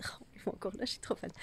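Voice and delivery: hushed whisper beside the trees. Real, not acted. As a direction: whisper it in awe. Whispers about the blossoms.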